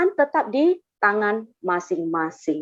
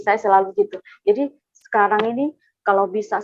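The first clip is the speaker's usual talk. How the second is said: usual speaking voice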